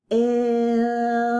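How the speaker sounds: long slow glide, no breaks; stretched smooth blend. Shown as a demonstration. Teacher showing the smooth blend to the class, stretched. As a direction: draw it out in one breath. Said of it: "Smooth blend".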